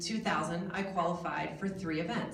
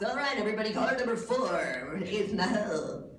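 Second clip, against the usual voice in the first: put-on radio DJ patter; hammed-up shock jock voice; morning shock jock. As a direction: play a morning radio host working the callers. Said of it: "one of those morning shock jocks".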